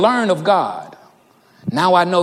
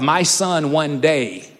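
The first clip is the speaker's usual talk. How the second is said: ordinary talk, nothing marked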